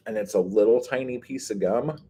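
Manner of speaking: ordinary talk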